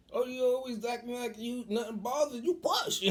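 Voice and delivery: high-pitched imitation of nagging encouragement; hammed-up high, goading voice; mock urging. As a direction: build to a loud high push. Mother's urging, voiced by him.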